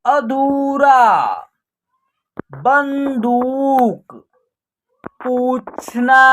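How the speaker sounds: slow, stretched syllables on a level pitch; drawn-out word drill. Shown as a demonstration. Teacher reading out a Hindi word list for practice, drawn out. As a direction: read each word slowly, holding the long vowels.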